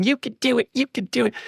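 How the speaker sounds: small high-pitched chant of encouragement; imitated child's cheering. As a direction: lightly mimic a little girl egging on a player. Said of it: "she's still up there pointing at him".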